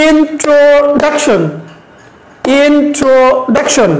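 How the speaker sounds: "introduction" drawn out very slowly, syllable by syllable; slow teacher model of "introduction"; slow syllable demo. Shown as a demonstration. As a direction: spell it out by sound, slowly.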